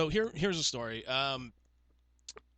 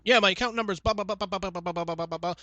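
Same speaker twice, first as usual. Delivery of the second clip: higher rapid-fire blah-blah stream; imitated rattling caller; 5 semitones higher, 4 dB louder than usual. Caller reeling off an account number, mock rapid rattle. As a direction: rattle off the number without a breath. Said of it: "because you were going like this".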